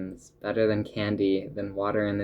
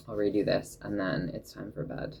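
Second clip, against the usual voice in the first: normal talking voice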